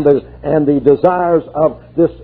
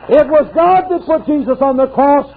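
high, full-voiced declaration; fervent preacher proclaiming the cross; preached cry; 12 semitones higher than usual. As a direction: proclaim it to the rafters. Preached, then restated plainly at 128.

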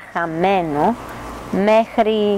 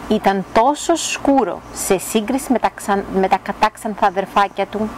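ordinary delivery

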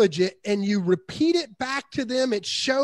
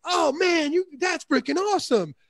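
much higher, gushing over the news; gushing seller; 7 semitones higher than usual. Retold or imitated. Average seller to client about his boys, gushing high. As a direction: overdo the excitement like an average seller.

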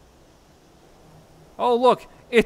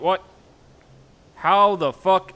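usual speaking voice

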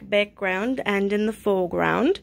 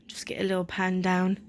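soft whispered instruction; 5 semitones lower, 8 dB quieter than usual. Real, not acted. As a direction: whisper it off to the side. Hushed aside to camera.

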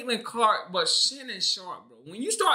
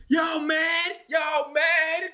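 high mocking shout of the fans' line; mocking y'all mad; 5 semitones higher, much slower than usual. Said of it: "Now it's".

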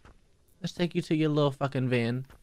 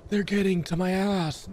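mocking quote; 6 semitones higher, slower than usual. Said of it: "That motherfucker said".

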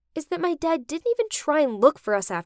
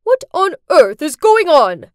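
much louder angry shout; furious yell; 4 semitones higher, 11 dB louder than usual. Acted. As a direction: roar it across the church. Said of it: "yelled in front of everyone".

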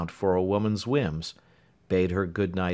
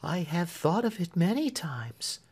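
gentle; 10 semitones higher than usual. A narrator's reading. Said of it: "said Marian gently".

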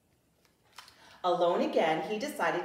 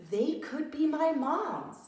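高声的小狮子，满怀希望; hopeful little lion; 8 semitones higher than usual. Acted. Lost cub hopes, voiced high.

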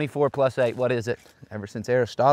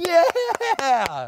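long, high whoop of victory; triumphant yell. Real, not acted. Golfer celebrating an eagle, triumphant yell. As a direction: let out a drawn-out yell.

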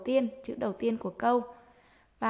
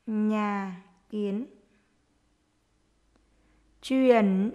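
dictating; slower than usual. Shown as a demonstration. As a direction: dictate word by word to young pupils. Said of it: "hãy nghe cô giáo đọc để chúng mình viết".

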